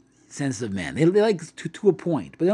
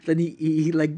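ordinary talk

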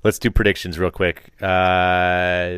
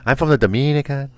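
higher-pitched mock Dominican accent; Dominican skit accent; 7 semitones higher than usual. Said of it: "what's that skit from".

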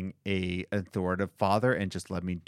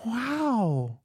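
mock grateful; 11 semitones higher, slower than usual. Mock thanks for whiskey gift.

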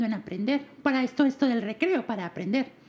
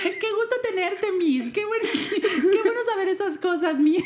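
high, slow, laughing mock praise; laughing sarcasm; 5 semitones higher, slower than usual. Real, not acted. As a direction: gush through laughter, teasing. Mock delight at confession.

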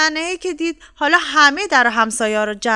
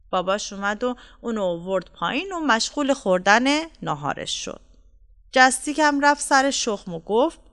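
ordinary delivery, nothing marked

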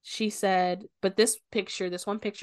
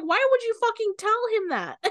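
high, loud mock outburst; outraged; 6 dB louder than usual. Retelling Apollo yelling at Patrice, high mock outrage. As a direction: throw the disbelieving anger up high.

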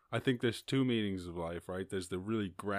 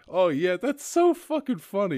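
much higher and louder sarcastic quip; mock them with scorn; 11 semitones higher, 13 dB louder than usual. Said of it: "you're like".

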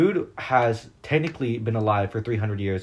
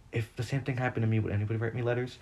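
self-pitying murmur; 7 dB quieter, faster than usual. Retold or imitated. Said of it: "self-pity system I have went".

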